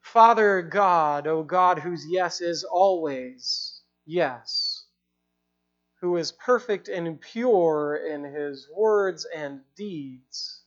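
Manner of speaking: slow, low, reverent prayer voice; closing prayer. Acted. Preacher leading the church in prayer, slow and reverent.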